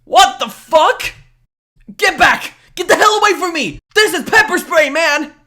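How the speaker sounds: loud, high-pitched panic; panicked shouting. Acted. Scared man wards off girl with pepper spray, panicked yelling. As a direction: yell to keep an attacker back.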